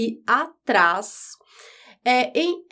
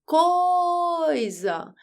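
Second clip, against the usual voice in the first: drawn-out, higher-pitched call on the first syllable of coisa; long sing-out call; 6 semitones higher than usual. Shown as a demonstration. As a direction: call it like a name at someone's door, holding "coi". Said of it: "Você grita assim".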